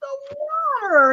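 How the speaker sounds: retold complaint. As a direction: whine it like a wounded party. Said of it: "I'm like".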